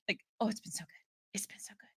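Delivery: hushed, voiceless savouring; delighted whisper. Real, not acted. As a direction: whisper it as if relishing it.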